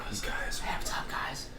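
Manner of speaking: mock ASMR whisper into the mic; breathy hush like an ASMR intro. Friend asks for ASMR, he answers in a whisper. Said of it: "Just start whispering in the mic".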